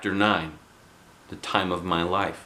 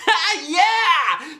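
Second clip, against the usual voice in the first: much higher mocking yell; a bully's gleeful shout; shouted jeer; 4 dB louder than usual. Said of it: "shouted Jim".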